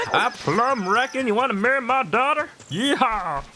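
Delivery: put-on southern accent. Streamer imitating Talon, hammed-up southern accent. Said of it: "Talon clearly has a southern accent".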